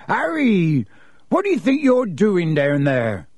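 high opening call, then reproach; scolding gamekeeper. A narrator's reading. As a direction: call out to a straying boy. Gamekeeper calls out to the boy.

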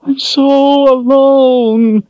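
high, slow, loud wail; very high drawn-out wailing cry. Acted. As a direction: stretch it out into a wail. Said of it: "she wailed".

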